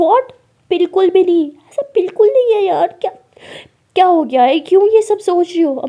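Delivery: very high, loud disbelief; shocked protest. Acted. Alia rejects that their relationship is weak, in loud shock. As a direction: deny it at once, aghast and loud.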